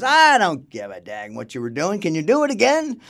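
loud, brushing it off, climbing high; emphatic director. Retold or imitated. Director to painter who admits drinking, loud and emphatic. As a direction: imitate an impatient boss, pitch jumping on the question.

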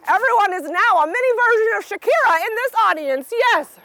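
ordinary delivery

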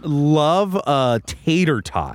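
slow, drawn-out declaration; slow savoring. Real, not acted. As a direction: savor each word slowly.

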